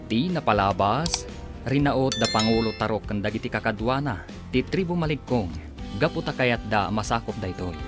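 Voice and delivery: normal talking voice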